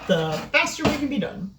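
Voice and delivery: ordinary talk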